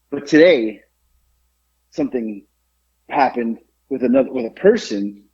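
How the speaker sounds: ordinary talk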